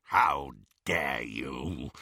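low, slow menacing snarl. A narrator's reading. Captured Greyback to the woman who took his wand, snarling low. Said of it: "he snarled".

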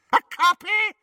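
high, loud witch screech. Acted. Bellatrix screeches at Hermione, high disbelieving witch screech. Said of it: "screeched Bellatrix".

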